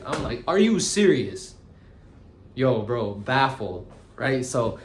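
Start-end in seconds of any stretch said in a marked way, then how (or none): 0.5-1.6 disbelief. Retold or imitated. Retold disbelief at the woman.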